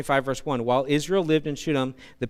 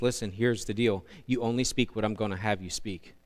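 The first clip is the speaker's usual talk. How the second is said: lower, softer stern voice of God; 7 semitones lower, 8 dB quieter than usual. Acted. Preacher voicing God instructing Balaam, low soft and stern. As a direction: speak low and firm as God.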